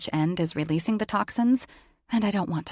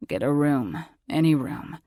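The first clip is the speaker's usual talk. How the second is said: low grumbly sick voice; grumbling; 3 semitones lower than usual. Acted. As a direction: grumble it out wearily. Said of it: "he grumbles".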